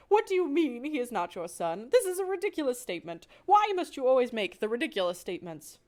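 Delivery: indignant French mother mouse; exasperated wife. Acted. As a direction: scold him for his nonsense. Wife quarreling with husband.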